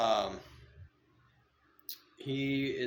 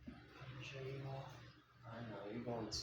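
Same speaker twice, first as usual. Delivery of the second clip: softer, hushed cooing to the snake; soft pet talk; 2 semitones higher, 12 dB quieter, faster than usual. Real, not acted. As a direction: coo gently at your pet. Cooing softly to his snake.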